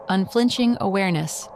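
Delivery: ordinary delivery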